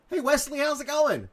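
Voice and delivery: high, loud cheery shout-out; calling out greeting. Real, not acted. Host greets arriving viewer Wesley, calling out.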